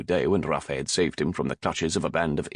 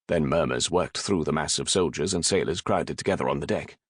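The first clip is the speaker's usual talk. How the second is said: ordinary delivery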